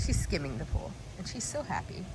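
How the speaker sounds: ordinary talk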